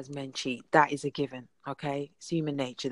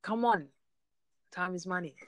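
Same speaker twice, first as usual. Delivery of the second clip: normal talking voice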